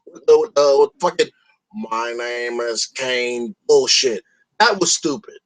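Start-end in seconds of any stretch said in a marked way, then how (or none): 1.7-3.5 slow, low and softer Kane voice; mocking Kane. Mocking how Kane talks on WWE, slow and low. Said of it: "Letting Rusev talk is like having Kane talk".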